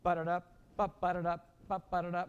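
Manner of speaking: "ba-ba-da-da" with a springing extra "ba" before the accent; pickup added. Shown as a demonstration. Director demonstrating the rhythm to repeat, accented pickup. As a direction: bounce from the pickup "ba" into "ba-da-da". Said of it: "We're gonna add the eighth note".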